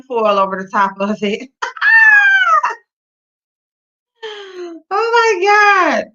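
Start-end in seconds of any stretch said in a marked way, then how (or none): 1.5-2.7 a sudden squealing burst of laughter; real shrieking laugh at the chat; high shrieking laugh. Real, not acted. 4.2-6.0 stretched, high, loud laughing cry; real shrieking laugh, very slow and high; screaming laugh. Real, not acted. Laughing scream after joke.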